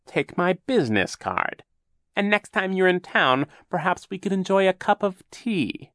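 sweet-talking fake captain; oily villain voice. Captain Sham flirts.

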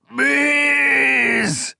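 very high, long drawn-out wail. Acted. The marshal begging under torture, long high wail. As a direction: wail the plea in agony. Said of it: "the marshal wailed".

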